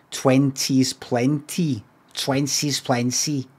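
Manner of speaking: slow, non-Scottish Twenties plenty so it rhymes; wrong accent. Shown as a demonstration. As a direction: say Twenties plenty slowly the way others would.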